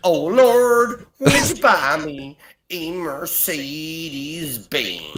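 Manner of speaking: put-on sing-song American accent, dragged out slowly. Asked by the host, a Geordie's slow American accent. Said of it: "Can you do an American accent?".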